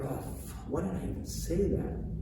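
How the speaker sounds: hushed, breathless self-reproach; ashamed whisper. Retold or imitated. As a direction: mutter it under your breath, regretful. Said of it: "deep down inside, they're like".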